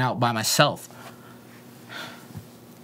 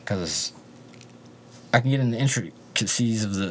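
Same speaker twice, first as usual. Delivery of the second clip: ordinary talk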